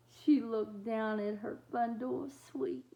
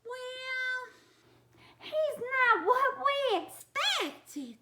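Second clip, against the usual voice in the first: high, loud mother-monster voice; Missus Monster; 13 semitones higher, 7 dB louder than usual. Acted. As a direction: squeal it like a startled monster mum. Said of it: "said Missus Monster".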